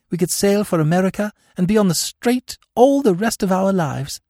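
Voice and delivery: high, emotional confession building in pitch; growing emotion. A narrator's reading. Captive woman confessing to Smith, growing emotion. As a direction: let the distress build as you confess. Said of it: "her emotion growing momentarily greater".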